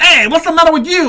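imitating Baz's Italian accent, loud and high; mock New York Italian. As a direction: play a brash New York Italian tough guy. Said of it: "Baz was like".

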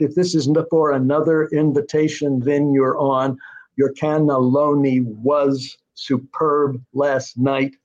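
slow, measured line with the beat on every second syllable; iambic line reading. Shown as a demonstration. The writer showing the interviewer how the dialogue scans. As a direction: read it like verse, stressing cannelloni and superb. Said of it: "It's all in iambic".

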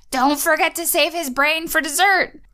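high sinister mermaid voice; villain mermaid. Retold or imitated. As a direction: relish the menace. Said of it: "And the mermaid says".

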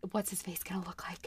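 hushed gossipy whisper; softly whispered guest chatter. Retold or imitated. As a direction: whisper it like a nosy guest leaning in. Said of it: "everyone's like".

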